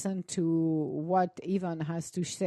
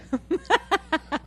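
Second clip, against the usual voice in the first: raised, loud chuckling burst; amused laugh; 8 semitones higher, 6 dB louder than usual. Real, not acted. Host joking about pie want list, amused laughter. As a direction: laugh at your own joke.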